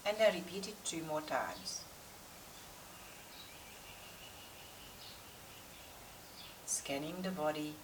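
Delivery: calm, unhurried yoga meditation with long pauses; guided meditation. Real, not acted. Yoga teacher settling class before practice, guided meditation voice. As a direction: guide the class inward, leaving space between instructions.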